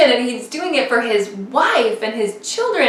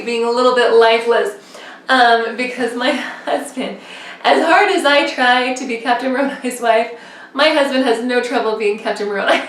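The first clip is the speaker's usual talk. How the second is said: usual speaking voice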